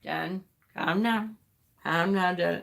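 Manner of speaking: imitating a husband's low-key, drawn-out calming; soft, slow mock soothing; Peter calming her. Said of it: "the whole time Peter's going".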